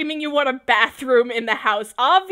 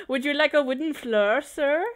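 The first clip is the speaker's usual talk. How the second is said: mock posh waiter accent; 3 semitones higher than usual. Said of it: "I don't know what accent that was".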